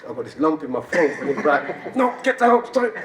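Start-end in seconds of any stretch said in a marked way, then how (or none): 2.0-2.9 high, loud order to himself; mock self-scolding. Man ordering his rising tears back down, mock self-scolding. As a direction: act out frantically pushing a feeling down. Telling himself off in retold story.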